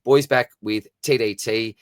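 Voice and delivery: ordinary delivery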